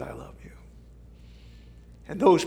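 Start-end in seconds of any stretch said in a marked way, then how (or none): 0.0-0.5 much softer, tender words from the cross; tender hush. Acted. Preacher voicing Jesus whispering love from the cross. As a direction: barely voice it. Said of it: "he said".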